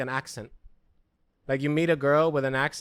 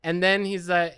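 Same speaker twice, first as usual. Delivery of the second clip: ordinary talk, nothing marked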